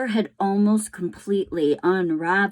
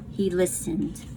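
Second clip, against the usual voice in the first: ordinary delivery, nothing marked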